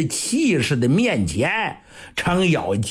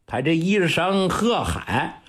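ordinary delivery, nothing marked

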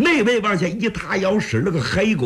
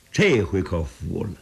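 plain reading voice

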